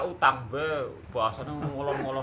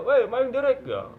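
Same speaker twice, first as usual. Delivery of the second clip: Indramayu friend; 11 semitones higher, 7 dB louder than usual. Retold or imitated. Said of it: "Lah ternyata".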